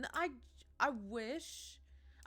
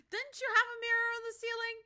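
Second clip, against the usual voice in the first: laughing question; 12 semitones higher, 6 dB louder, faster than usual. Real, not acted.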